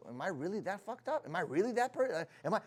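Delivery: hushed, hesitant self-questioning; self-doubting. Acted. Someone questioning their own sanity, quiet self-doubt. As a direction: question yourself quietly, faltering. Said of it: "So you start questioning".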